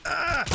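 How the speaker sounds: sudden high "ah" yelp; short, high yelp; startled yell. Real, not acted. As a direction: cry out high in surprise. Startled during the game.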